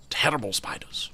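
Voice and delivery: eerie hushed whisper; whispered creepy aside. Acted. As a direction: breathe it like a secret. Whispers about the spider.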